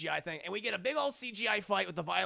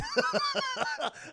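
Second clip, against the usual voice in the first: evil cackle. Retold or imitated. Violator mocks Spawn.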